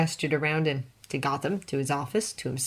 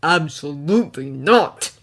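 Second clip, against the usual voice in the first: louder refusal ground out through teeth; through clenched teeth; 7 dB louder than usual. A narrator's reading. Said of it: "teeth clenched".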